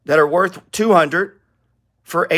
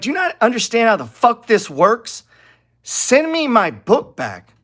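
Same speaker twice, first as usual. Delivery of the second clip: higher, exasperated outburst at complainers; exasperated rant; 8 semitones higher than usual. Retold or imitated. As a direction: vent disbelief at people who don't get it. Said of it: "I'm like".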